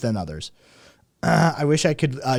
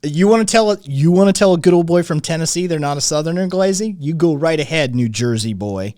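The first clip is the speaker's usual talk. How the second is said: put-on louder good-old-boy taunt; pitched-up, forceful Southern needling; mock Southern challenge; 5 semitones higher, 7 dB louder than usual. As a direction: dare him like a Tennessee good old boy.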